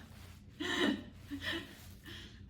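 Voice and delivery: airy high giggle; high breathy laugh. Real, not acted. Tired laugh.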